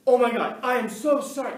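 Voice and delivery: high, loud, shocked apology from the mother; mother mortified her son hit a car, loud and high; horrified apology. Retold or imitated. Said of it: "she turns and she goes".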